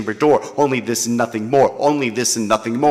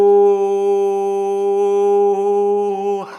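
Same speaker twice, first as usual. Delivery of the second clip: high held laugh; 8 semitones higher than usual. Real, not acted. Laugh after the performance.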